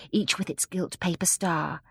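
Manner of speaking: ordinary delivery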